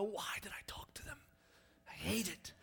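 whispered, breathy complaint; whispered regret. Retold or imitated. Bitten person back on the couch, whispering regret. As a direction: hiss it to yourself on the couch. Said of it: "you go".

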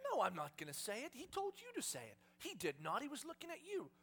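high-pitched squabbling refusal; bickering. Acted. Two disciples arguing over who has to say it, bickering. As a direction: squabble like reluctant accomplices.

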